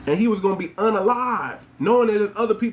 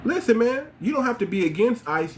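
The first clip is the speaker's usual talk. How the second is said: ordinary talk, nothing marked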